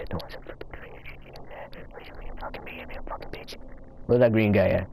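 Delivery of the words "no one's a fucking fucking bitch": much softer, fading muttered insult; barely-there whispered curse. Real, not acted. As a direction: whisper it under your breath. Muttering under breath.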